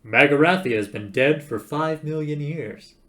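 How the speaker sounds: loud, impatient insistence; emphatic dismissal. Acted. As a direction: dismiss the worry loudly. Zaphod loudly dismisses the fear.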